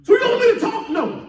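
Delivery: high, sharp no, then silence; indignant refusal. Acted. God refusing to speak to the late pray-er, high and sharp. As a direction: refuse the prayer flatly. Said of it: "God said".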